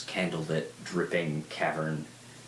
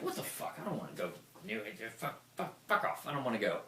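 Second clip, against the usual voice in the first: much softer, fast whiny muttering; grumbling protest; 4 semitones higher, 7 dB quieter, faster than usual. Retold or imitated. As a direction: grumble it under your breath. Retelling grumbling refusal.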